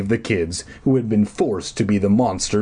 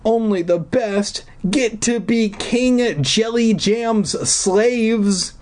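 put-on high, slow, trembling girl's voice; frightened girl; 12 semitones higher, slower than usual. Deirdre, high and slow, fearful of the king. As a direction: voice her as a scared captive.

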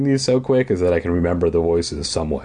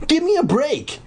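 high, quicker protest, less extreme; crying out. A narrator's reading. Brother protests to his blocking sister, toned-down high cry. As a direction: whine it out high without squealing. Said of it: "he cried".

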